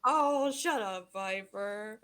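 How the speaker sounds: bashful, stretched-out shut up; playful brush-off. Acted. Mom brushes off child's praise.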